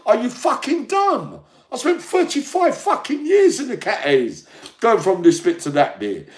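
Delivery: shouting high and loud, furious. Real, not acted. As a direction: tear into the commenters at full volume. Ranting at online commenters.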